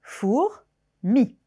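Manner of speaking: "four" and "mi" said apart with a pause between; syllables split. Shown as a demonstration. Teacher showing pupils the syllables, split apart. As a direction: cut "four" from "mi" with a clear pause. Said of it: "on entend deux syllabes".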